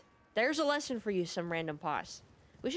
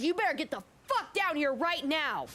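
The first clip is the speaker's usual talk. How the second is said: high, mock-furious demand; imitating livid customer; 8 semitones higher than usual. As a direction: act out an enraged customer's order. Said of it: "she's fucking livid. She's fuming".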